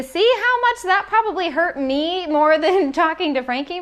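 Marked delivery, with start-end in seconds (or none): none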